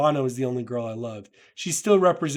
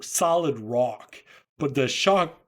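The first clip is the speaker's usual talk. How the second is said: ordinary delivery, nothing marked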